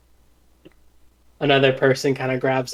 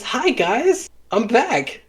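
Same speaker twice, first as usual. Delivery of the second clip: lightly raised, chirpy hello; cheery Akia voice; 9 semitones higher, much slower than usual. Acted. GM as Akia greeting the party, lightly chirpy. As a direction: pop back in brightly like nothing happened.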